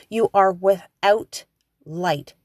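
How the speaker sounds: ordinary delivery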